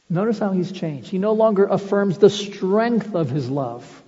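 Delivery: usual speaking voice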